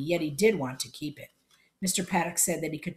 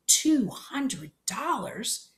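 "Two hundred dollars?" drawn out very slowly, pitched higher; awed disbelief; 6 semitones higher, much slower than usual. Acted. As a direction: let the sum sink in, wide-eyed and slow. Boy stunned by the sum.

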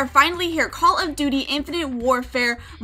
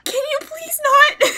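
high, slow, laughing protest; real laughter in a high voice; laughing plea; 13 semitones higher, 6 dB louder, slower than usual. Real, not acted. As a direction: beg it to stop through laughter.

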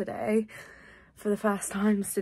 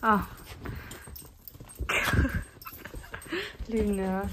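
ordinary talk, nothing marked

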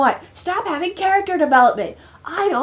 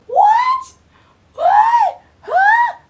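much higher, loud stunned cries; shocked; 5 dB louder, much slower than usual. Real, not acted. Gamer yelps in shock at the sibling reveal. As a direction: yelp in disbelief at the twist.

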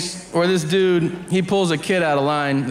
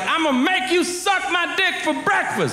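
much higher, laughing mock tough-guy voice; mock prisoner taunt; 13 semitones higher than usual. Comedian quoting a prisoner taunting a middle schooler, high laughing mock voice. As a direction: ham up the prisoner's crude threat, cracking up. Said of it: "He's like".

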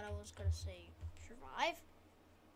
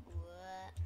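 weird voice; 5 semitones lower, 6 dB quieter than usual. Shown as a demonstration. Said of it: "my voice is going like".

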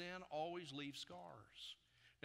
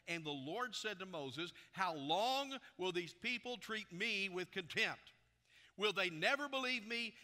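loud, raised preacher's reading voice; forceful scripture reading; 4 semitones higher, 7 dB louder than usual. A narrator's reading. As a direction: proclaim the Lord's words to the whole congregation. Reads scripture aloud at full force.

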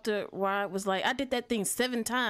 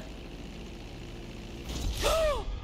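a high, breathy gasp of shock; sharp gasp; 13 semitones higher, 3 dB quieter than usual. Real, not acted. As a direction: suck air in, startled. Sudden shock in game.

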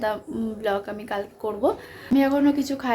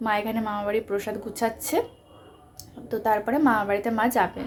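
normal talking voice

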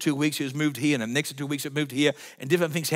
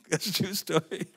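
laughing; 6 semitones higher, 5 dB quieter than usual. Real, not acted.

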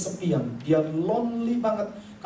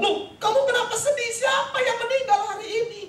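retold puzzled shout. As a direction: press her urgently. Luther presses his grieving wife.

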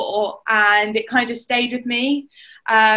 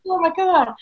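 mock exasperation; 7 semitones higher than usual. Herself groaning after ball hits.

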